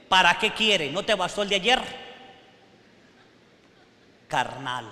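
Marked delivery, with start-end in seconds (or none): 0.1-2.1 loud, irritated retort; loud, put-out grumble; annoyed husband. Retold or imitated. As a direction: snap back at the wife over money. Carnal husband scolds wife.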